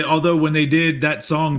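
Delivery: ordinary delivery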